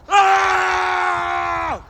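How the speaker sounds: terrified scream. Acted. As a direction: shriek at the monster. Sees the dinosaur.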